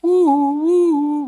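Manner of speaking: high, loud woo-woo siren; siren wail. Acted. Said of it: "went a siren coming through".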